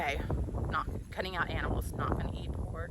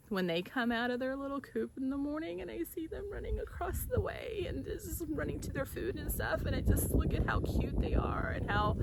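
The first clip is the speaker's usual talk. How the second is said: emotional; 8 semitones higher, 4 dB quieter than usual. Real, not acted. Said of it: "I'm getting a little emotional".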